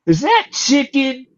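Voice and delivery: high, slow sniffing question; woman at the desk asking, high and slow; curious clerk. Retold or imitated. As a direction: ask it high and drawn out. Front desk woman smells chicken.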